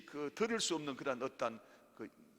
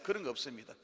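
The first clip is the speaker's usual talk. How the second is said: ordinary talk, nothing marked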